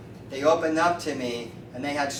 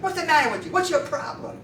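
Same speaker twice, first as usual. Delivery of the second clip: high-pitched needling question; mock incredulous challenge; 13 semitones higher than usual. Street man challenges the unmarried chiropractor, mock incredulous. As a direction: push the voice up, as if appalled.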